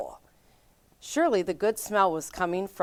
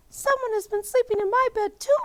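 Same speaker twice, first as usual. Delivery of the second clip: high-pitched indignant mother bear; Mama Bear cry. A narrator's reading. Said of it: "Mama Bear cried".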